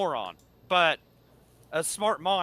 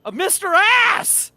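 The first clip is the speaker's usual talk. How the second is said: drawn-out yell; 10 dB louder, much slower than usual. Retold or imitated. Chanting wrestler's name.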